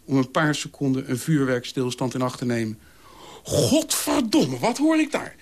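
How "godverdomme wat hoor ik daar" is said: high-pitched outraged explosion, drawn-out curse; furious ranting. A narrator's reading. Dolf exploding at what he just heard, furious. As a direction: erupt in indignant rage. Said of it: "tierde Wolf".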